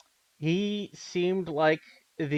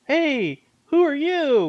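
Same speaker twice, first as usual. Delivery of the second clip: surprised Justin; 12 semitones higher, 6 dB louder, much slower than usual. Retold or imitated.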